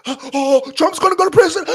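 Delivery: high, loud shocked gasp; mock panicked fan. Mocking people who think Trump is going to prison, gasping in panic. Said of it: "They're the ones like".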